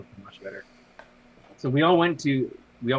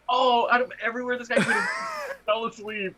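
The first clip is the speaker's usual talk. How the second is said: laughing; 9 semitones higher than usual. Retold or imitated. Retold laughing exclamation.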